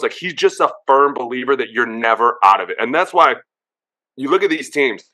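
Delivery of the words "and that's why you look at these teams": usual speaking voice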